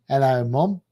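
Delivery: subtly Australian hello; Australian version for a side-by-side comparison; Australian accent. Shown as a demonstration. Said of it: "that's my Australian".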